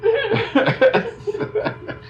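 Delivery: squeaky high laugh; real high-pitched laughter. Real, not acted. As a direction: crack up at the memory. Laughs after the punchline.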